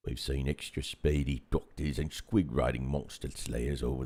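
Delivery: milder orc announcer. Pretend. Orc introduces a look at new releases, orc announcer voice. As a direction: present the show in orc character. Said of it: "could you put on your orc voice?".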